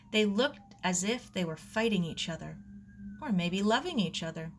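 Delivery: plain reading voice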